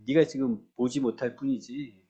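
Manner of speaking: ordinary talk, nothing marked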